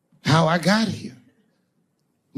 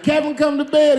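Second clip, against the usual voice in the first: much higher, louder; wife calling; 11 semitones higher, 6 dB louder than usual. Retold or imitated. Wife calls Kevin to bed, high and loud. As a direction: call him like an annoyed wife. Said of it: "his wife be like".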